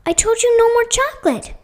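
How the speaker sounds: high, loud reprimand; scolding. A narrator's reading. Marta to Leanna about the chocolate, scolding. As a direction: tell the child off sharply. Mother scolding her child.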